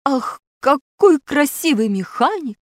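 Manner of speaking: high, oddly admiring exclamation; strange voice. A narrator's reading. Pashka stares at the mechanic, admiring in a strange voice. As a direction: gush admiringly, strangely, pitch lifted. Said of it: "добавил странным голосом".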